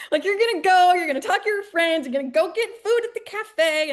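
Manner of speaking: emphatic scolding. Real, not acted. Warning students about wasted days, loud and emphatic. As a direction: rattle off how they'll waste the day.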